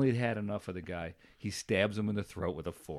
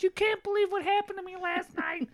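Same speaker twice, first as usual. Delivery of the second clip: much higher put-on wiseguy voice; Nikki's voice. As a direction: pitch it way up like a chatty barfly. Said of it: "this is how we talked".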